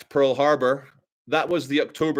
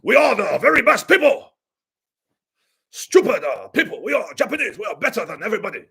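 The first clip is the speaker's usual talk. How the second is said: higher, louder boastful mock voice; arrogant; 5 semitones higher, 4 dB louder than usual. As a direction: puff up with mock superiority. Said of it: "like, super arrogant".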